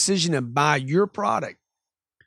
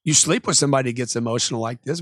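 ordinary talk

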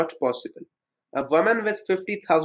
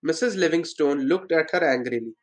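ordinary delivery

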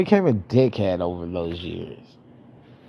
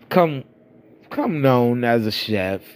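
ordinary delivery

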